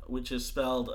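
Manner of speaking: ordinary talk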